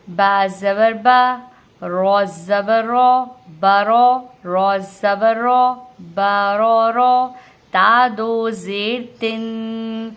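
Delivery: slow, loud, drawn-out syllable chant; spelling drill. Shown as a demonstration. Teacher drills a long word with learners, slow loud chant. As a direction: build the word piece by piece, holding the nasal ending.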